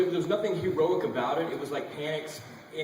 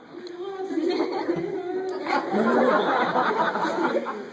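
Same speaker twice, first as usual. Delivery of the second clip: long high helpless laugh; 6 dB louder than usual. Real, not acted. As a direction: crack up and keep laughing. Laughing at crew eating.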